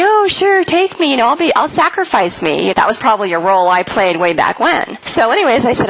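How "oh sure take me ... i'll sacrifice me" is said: much higher, sing-song self-sacrifice; mock martyr. Wife mocking her old self-sacrificing role, sing-song. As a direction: parody a doormat offering herself up.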